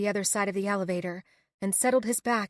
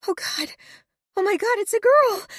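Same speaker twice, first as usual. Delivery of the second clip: high, loud tearful cry of joy; 12 semitones higher, 6 dB louder than usual. A narrator's reading. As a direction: sob it out in disbelief. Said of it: "I cry".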